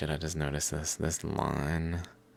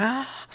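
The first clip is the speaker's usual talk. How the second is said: startled squawk, pitched up; high bird squawk. Retold or imitated. Scared bird squawking high. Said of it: "it's like".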